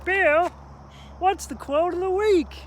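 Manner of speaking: high, loud mock audience voice; viewer's eager question. The host playing a viewer asking him, high excited shout. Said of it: "So you may be asking yourself".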